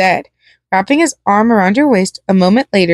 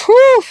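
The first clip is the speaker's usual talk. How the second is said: psychopathic whoop; 4 dB louder than usual. A narrator's reading. Clown laughing like a psychopath.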